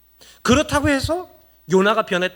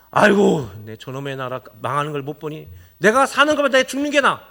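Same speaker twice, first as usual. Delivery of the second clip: sudden loud wail, then low grumbling; acting out Jonah; 6 semitones lower, 4 dB louder than usual. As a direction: play a fed-up prophet wishing to die. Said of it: "요나가 그거 지켜보다가 뭐라 했냐면". (지친 요나 흉내, 큰 탄식 후 낮은 투덜거림)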